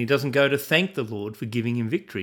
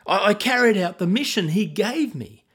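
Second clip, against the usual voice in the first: defensive insisting; 7 semitones higher than usual. A narrator's reading. Saul justifying himself to Samuel, insistent and raised. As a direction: stammer the excuse with rising defensiveness. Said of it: "Saul insisted".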